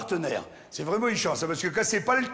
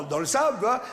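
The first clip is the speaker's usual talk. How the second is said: ordinary talk, nothing marked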